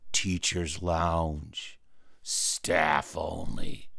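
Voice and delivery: very slow, low and drawn out; ominous sign reading. Acted. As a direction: read the forbidden sign with slow dread.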